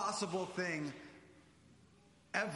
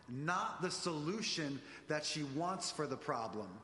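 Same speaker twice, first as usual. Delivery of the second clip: ordinary talk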